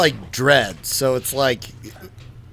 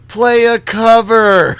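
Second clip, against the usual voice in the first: put-on higher, louder, slow drunken call from the crowd; heckling shout; 8 semitones higher, 7 dB louder, slower than usual. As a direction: yell it at the band between songs. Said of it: "I was like".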